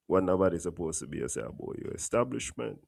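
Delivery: usual speaking voice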